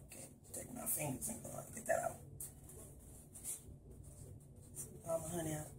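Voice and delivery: unvoiced, fast mutter to herself. Real, not acted. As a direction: say it under your breath while scraping. Muttering while scraping honey.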